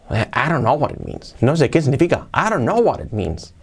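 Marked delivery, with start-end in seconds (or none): 0.3-1.4 "I don't know what it means" peaking on "know", tail falling; with intonation. Shown as a demonstration. Teacher repeating real English, melodic linked phrase. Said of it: "con entonación". 2.3-3.5 "I don't know what it means" in one swooping run; real-English intonation. Shown as a demonstration. Teacher showing real English, natural melodic delivery. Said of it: "Así sería, inglés real".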